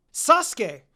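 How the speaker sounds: calling the name out loud and high; shouted name. A narrator's reading. Kira blurting out the name of her crush, shouting. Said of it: "practically shouted his name".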